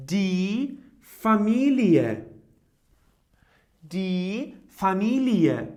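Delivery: "Familie" slowly, ending as a separate ie-e; slow pronunciation demo. Shown as a demonstration. Teacher showing the other way to say ie, slowly. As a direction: open up the end of "Familie" slowly. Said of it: "It seems like ie".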